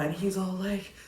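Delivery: ordinary delivery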